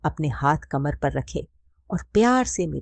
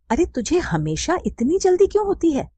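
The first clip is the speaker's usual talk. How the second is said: high, louder teasing scold; mock anger; 13 semitones higher, 4 dB louder than usual. A narrator's reading. Mother playfully scolding her impatient son, mock angry. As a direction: pretend to be cross, playfully. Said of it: "माँ मजाक में गुस्सा होकर बोली".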